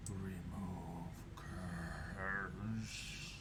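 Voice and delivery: low, soft, whispered monster voice; distorted voice. Acted. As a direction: rasp it out slow and eerie. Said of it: "You hear a distorted voice".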